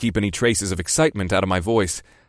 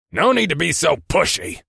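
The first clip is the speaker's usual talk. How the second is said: higher grumbling gruff boss; 8 semitones higher than usual. Acted. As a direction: grumble it back, offended. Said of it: "he immediately grumbled".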